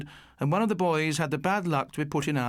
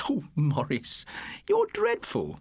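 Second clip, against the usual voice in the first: giggly scolding; slower than usual. A narrator's reading. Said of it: "she couldn't help giggling".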